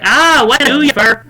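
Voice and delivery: loud, high mock-Irish greeting; attempted Irish accent. Shopkeeper greeting a customer in loud, high attempted Irish. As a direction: burst into an overdone Irish accent. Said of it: "Dare I try an Irish accent?".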